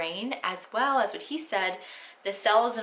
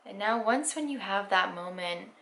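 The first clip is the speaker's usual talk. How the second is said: guided meditation; 6 dB quieter than usual. Acted. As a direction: ease them into stillness, unhurried.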